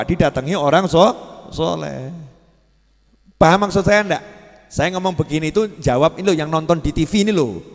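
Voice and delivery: normal talking voice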